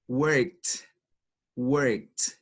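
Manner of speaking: worked said as one syllable, "werkt", final t; English worked. Shown as a demonstration. Teacher demonstrating worked for students. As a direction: clip worked to one beat ending in a t sound. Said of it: "Vamos entonces a pronunciar los verbos".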